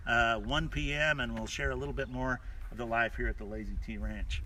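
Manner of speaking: usual speaking voice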